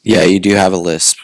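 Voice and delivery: just-woke-up radio voice; morning radio voice. Real, not acted. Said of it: "morning radio voice on".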